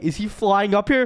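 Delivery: usual speaking voice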